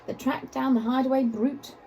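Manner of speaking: plain reading voice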